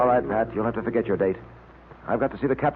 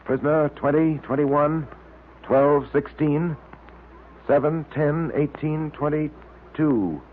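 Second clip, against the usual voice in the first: each number spelled out slowly and deliberately; slow code reading; much slower than usual. Acted. Detective working through a coded message from Pat, slow and careful. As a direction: read the digits like you're decoding them in your head.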